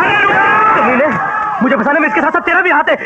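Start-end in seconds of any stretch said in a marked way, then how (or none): 0.0-1.1 angry shout. Acted. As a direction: spit the insult out in rage. Son curses in rage.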